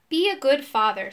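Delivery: plain reading voice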